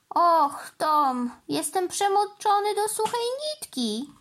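high-pitched storybook grumble; indignant snort. A narrator's reading. Norman, soaked by the helicopter's water, complaining to Tom in a huff. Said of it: "prychnął".

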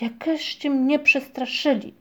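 high, slow, shaken-up complaint; startled exclamation. A narrator's reading. Paszczak scolds those who frightened him, startled exclamation. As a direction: cry it out, drawn out after a fright. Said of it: "krzyknął Paszczak".

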